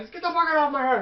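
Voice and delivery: high-pitched, exasperated outburst; mock mad player. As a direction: imitate a buddy losing his temper. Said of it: "He gets so mad".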